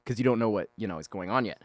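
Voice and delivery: normal talking voice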